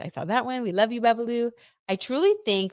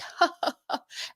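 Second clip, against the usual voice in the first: imitated giggle, light and high; mock flirty laugh; 10 semitones higher, 6 dB quieter than usual. Streamer imitating a flirting couple, mock laughter. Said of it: "like, laughing and".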